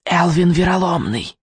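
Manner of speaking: witch whispering a name; lightly voiced whispering crone; old woman whispering. A narrator's reading. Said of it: "прошептала ведьма во мраке".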